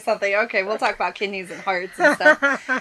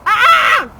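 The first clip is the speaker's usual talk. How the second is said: high loud pained gasp; 11 semitones higher, 8 dB louder than usual. Real, not acted. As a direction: gasp after choking. Choking on cinnamon.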